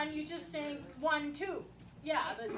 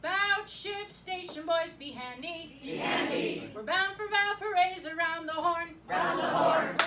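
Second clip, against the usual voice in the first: louder, hearty chanted call and echo; enthusiastic shanty chorus; 5 dB louder than usual. Shown as a demonstration. Leader rousing a sleepy crowd, loud chanted chorus. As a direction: belt it out like a crew at the capstan. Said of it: "put a little enthusiasm in it".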